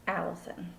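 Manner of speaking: ordinary delivery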